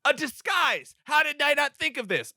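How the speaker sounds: high, loud eureka outburst; hammed-up excited discovery; excited realisation. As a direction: burst out with a mock brainwave. Voicing man's sudden excited idea.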